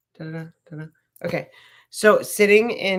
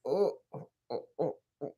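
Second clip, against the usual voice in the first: soft ooh; 3 semitones lower, 9 dB quieter, faster than usual. Real, not acted. Pressing her own armpit point.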